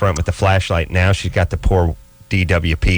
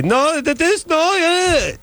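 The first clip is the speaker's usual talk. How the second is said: very high-pitched mock Israeli woman; Israeli neighbor accent; slower than usual. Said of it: "sixty-year-old Israeli".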